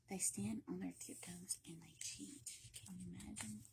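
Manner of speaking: hushed secret whisper; sneaky storyteller whisper. Acted. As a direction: lean in and whisper it. Conspiratorial aside about cheating.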